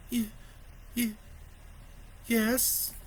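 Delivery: high, halting, tearful; hesitant child. A narrator's reading. Boy answering his father, hesitant and tearful. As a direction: stammer it uncertainly.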